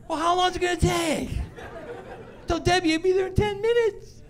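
high whiny teen voice, imitating; mock impatient teenager; whiny teenage daughter. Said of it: "they look at me and go".

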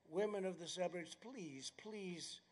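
hushed mock begging; joking plea. Speaker replaying his plea to suburban women, joking and pleading. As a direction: plead very softly, tongue in cheek.

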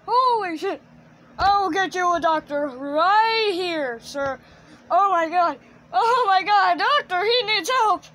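hammed-up alarm, climbing to a high yell; panicked puppet. Puppet calling a doctor for his friend, loud hammed-up panic. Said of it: "Chair Puppet's like".

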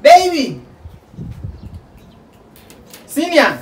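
loud, high calls across the distance; calling out. Real, not acted. Calling out loudly to someone far away. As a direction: shout the name out to someone far off.

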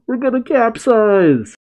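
high-pitched hammed-up panic cry; shrieky alarm without much volume; mock yell. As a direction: wail it in pretend terror. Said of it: "Usopp yells out".

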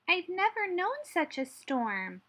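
high-pitched mouse squeak; squeaky Mother Mouse. A narrator's reading. Mother Mouse telling Hedgehog about the storm, squeaky. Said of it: "squeaked Mother Mouse".

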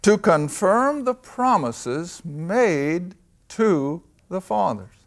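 slow, deliberate reading; weighty, unhurried reading of the verse; slow scripture reading. Shown as a demonstration. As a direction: lay each word down slowly. Reading scripture slowly, word by word.

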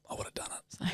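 whispered confession; soft whispered admission. Real, not acted. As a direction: whisper it like a secret.

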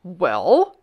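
loud kingly WELL, swooping up at the end; insistent demand. A narrator's reading. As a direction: press hard for an answer. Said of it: "he demanded".

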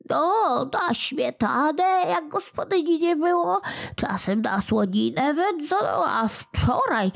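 a guilty imp making excuses; whiny little goblin explaining. Acted.

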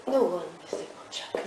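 ordinary talk

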